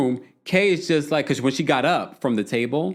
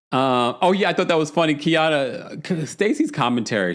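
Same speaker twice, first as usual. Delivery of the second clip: normal talking voice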